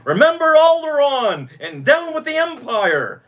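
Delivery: high-pitched, mock rallying cry; imitated battle shouting. Fan quoting Luke racing around the Muppet Theater, imitated shouting. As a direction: cry it out like a rebel slogan, quoting. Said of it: "he, shouting".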